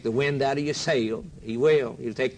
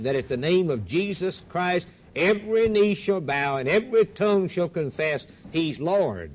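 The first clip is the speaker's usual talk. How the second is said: ordinary delivery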